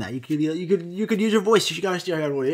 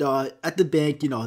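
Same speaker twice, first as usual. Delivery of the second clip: ordinary talk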